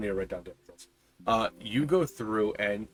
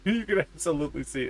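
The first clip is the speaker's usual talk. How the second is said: laughing; 7 semitones higher, 7 dB louder than usual. Real, not acted. GM laughing at player joke.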